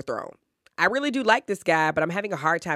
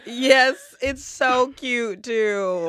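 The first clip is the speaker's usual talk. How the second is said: laughing; 6 semitones higher, much slower than usual. Real, not acted. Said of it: "Did you just snort?".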